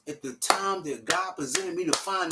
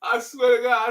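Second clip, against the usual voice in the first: ordinary delivery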